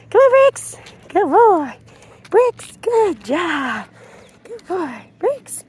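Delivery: high, bright-pitched praise for the dog; sing-song praise to a dog. Real, not acted.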